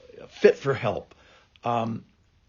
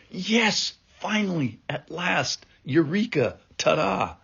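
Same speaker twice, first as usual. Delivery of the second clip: high, bright cries of joy, each word lifted; rejoicing Adam; 7 semitones higher than usual. Retold or imitated. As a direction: cheer like a man who finally found her. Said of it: "rejoice like Adam did".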